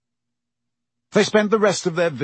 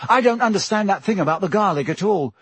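anxious Neville; 8 semitones higher, 3 dB louder, faster than usual. Acted. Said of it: "his round face anxious".

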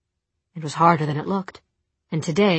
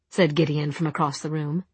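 ordinary delivery, nothing marked

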